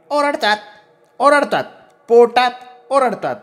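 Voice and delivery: very high, choppy retort; insistent crow-man. A narrator's reading. Man insisting crows call at night, high insistence. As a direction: squawk the words back in a high voice.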